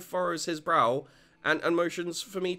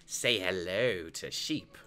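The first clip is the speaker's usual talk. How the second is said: lower, slow father prompt; slow, lowered dad voice; Joseph voice; 9 semitones lower, slower than usual. Acted. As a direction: gently coax the kids.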